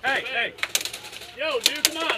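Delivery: shouting. Real, not acted. Protesting being shot.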